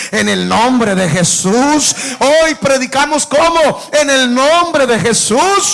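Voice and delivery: full-force preaching, almost shouting; rising preacher's fervor. Acted. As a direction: lift the church up with each phrase.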